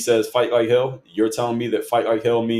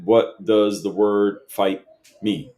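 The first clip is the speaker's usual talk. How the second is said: slow, deliberate reread of the question; much slower than usual. Retold or imitated. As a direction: spell out "What does the word fight mean?" word by word, slowly. Rereading Manny's question back to him.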